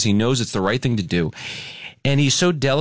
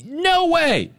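much higher, urgent shout; retold yell; 9 semitones higher, slower than usual. Traveler yelling at airport staff to help her, urgent shout. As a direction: yell it across the airport floor. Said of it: "I had to raise my voice and yell a little bit".

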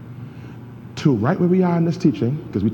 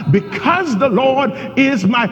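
full-force preaching; 9 semitones higher than usual. Acted. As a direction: preach it to the congregation with fire. Pastor preaching again.

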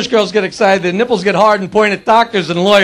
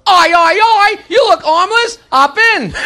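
British; 7 semitones higher, slower than usual. Acted. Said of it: "A British guy pulls over".

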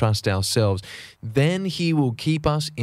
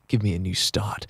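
soft unvoiced whisper, lower; whispered prayer; 3 semitones lower, 5 dB quieter than usual. Preacher voicing a sinner's prayer to Jesus, whispered. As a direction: breathe the plea like a private prayer. Voiced prayer asking for forgiveness.

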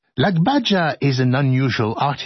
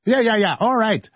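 usual speaking voice

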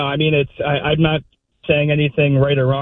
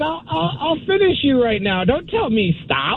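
high, threatening tiger voice; 11 semitones higher than usual. Retold or imitated. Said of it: "He gave him that look like".

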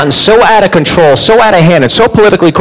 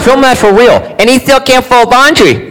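mocking the wife; 7 semitones higher than usual.

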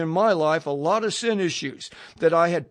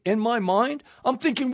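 ordinary delivery

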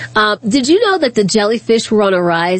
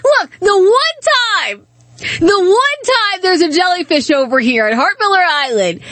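exasperated laughing; 5 semitones higher than usual. Retold or imitated. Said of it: "I said".

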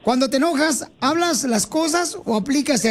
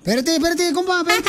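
high, quick calls to stop him; urgent call; 7 semitones higher than usual. Real, not acted. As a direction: call him back before he leaves. Host stops guest leaving.

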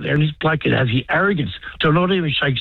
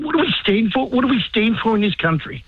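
worked up; 7 semitones higher than usual. Real, not acted. Veteran caller venting at the government, high and heated. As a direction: voice jumps much higher in outrage. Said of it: "I know you're getting worked up".

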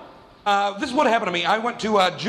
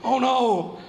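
mock panic; 5 semitones higher than usual. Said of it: "I was like".

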